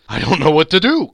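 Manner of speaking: loud, overwhelmed exclamation; laughing and crying. A narrator's reading. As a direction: cry out, giddy to the point of tears. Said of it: "laughing and crying in the same breath".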